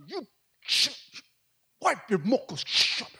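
high-pitched comic telling-off; mock-scold someone to hush up; imitated scolding wife. Wife to weeping husband in the restroom, high-pitched mock scolding.

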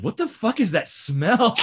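high, drawn-out mock outrage; appalled disbelief. Liz walks into the stinking bathroom, appalled disbelief. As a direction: act out a disgusted reaction, stretched and high-pitched. Roommate's disgusted reaction acted out.

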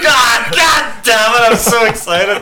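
laughing shout. Real, not acted. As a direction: crack up with glee. Excited before friend tastes whiskey.

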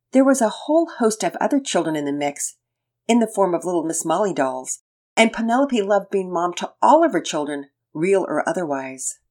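ordinary delivery, nothing marked

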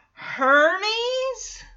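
high, drawn-out shocked "Hermes?"; disbelieving Hermes?. Retold or imitated. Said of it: "are all like".

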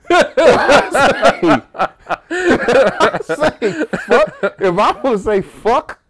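loud, high, laughing insistence; laughing defiance. Real, not acted. Insisting on his right to curse, laughing loudly. Cracking up at own comeback.

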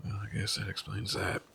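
soft, breathless resignation; resigned whisper. Acted. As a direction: let it go in a whisper.

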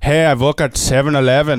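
mock Indian-accented greeting. Shown as a demonstration. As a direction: put on an Indian voice. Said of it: "essentially, with the Indian voice".

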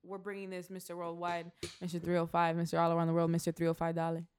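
low, monotone [name] hype intro; mock [name] ad read; imitating [name]. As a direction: roll out the titles like [name], low and level. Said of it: "[name] was just like".

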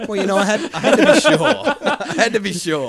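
high, loud, through laughter; laughing protest. Real, not acted. Podcaster defending skimpy research to co-hosts, laughing protest. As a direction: protest loudly while cracking up.

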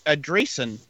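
ordinary delivery

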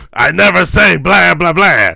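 loud, high-pitched hammed-up Dracula; mock Dracula voice. Co-host joking about the famous line, loud mock Dracula voice. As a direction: belt it out as an indignant cartoon Dracula.

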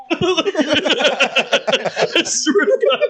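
very high, slow, half-crying plea; mock whimper. Imitating the jacked kid starting to cry. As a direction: whine it out like a big kid crying. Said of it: "he was like".

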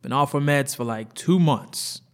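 urgent explaining. Acted. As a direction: push the doctor to help. Partner explains, pressed and urgent.

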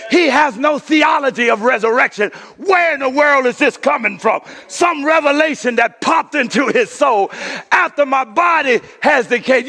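fired-up sermon, loud and rising; preaching. Acted. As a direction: preach it at full force to the church. Preaching to congregation.

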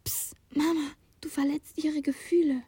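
put-on soft child's hushed plea; quiet hushed warning; whispering. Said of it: "flüsterte Ilsa".

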